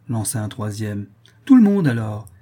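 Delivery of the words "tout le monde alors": loud, higher, jolly outburst; merry exclamation. A narrator's reading. Merry guest topping his friends' list, exclaiming loudly. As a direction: call it out gaily to the table. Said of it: "s'écria un des plus gais".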